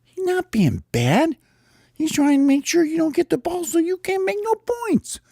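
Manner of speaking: high-pitched protesting character voice; high, indignant little player; arguing bird character. Acted. Bird squabbling over the game.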